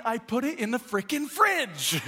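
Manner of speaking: high-pitched exasperated outburst; mock losing it, voice way up in frustration; worked up. Dad losing patience with his son, high exasperated outburst. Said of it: "I'm all worked up".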